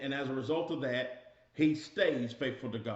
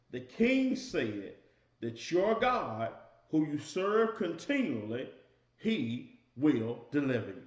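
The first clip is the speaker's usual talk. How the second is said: slow emphasis; slower than usual. Shown as a demonstration. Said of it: "grab it, y'all".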